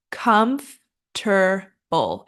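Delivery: slow COMFORTABLE in three parts, "KUMF, ter, bul"; COMFORTABLE broken down. Shown as a demonstration. Teacher breaks it down, COMFORTABLE as KUMF... ter... bul. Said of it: "we wanna break this down".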